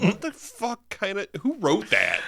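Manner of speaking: high, loud, laughing disbelief; incredulous laugh. Retold or imitated. Mocking the screenwriter, high and laughing. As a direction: squeal it in amused disbelief. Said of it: "I was like".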